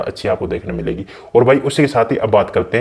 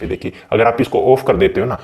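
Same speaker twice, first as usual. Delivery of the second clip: usual speaking voice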